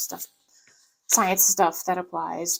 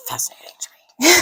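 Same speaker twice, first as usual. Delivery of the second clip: breathless, squeezed words dissolving into giggles; breaking into laughter; 6 dB louder, much faster than usual. Real, not acted. Vlogger tickled by the show, breaking into laughter. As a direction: laugh through the end of the phrase.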